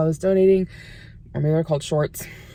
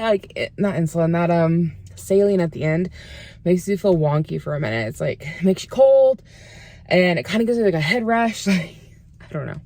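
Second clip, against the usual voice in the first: ordinary talk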